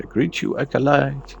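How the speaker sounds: ordinary talk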